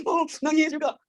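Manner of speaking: high laughing through oh nangis juga; real laughter. Real, not acted. Teacher laughing at her own joke about crying wives. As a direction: laugh it out, amused.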